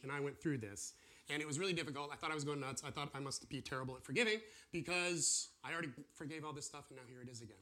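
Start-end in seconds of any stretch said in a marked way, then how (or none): none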